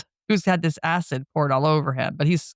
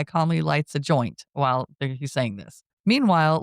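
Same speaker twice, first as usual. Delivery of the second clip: normal talking voice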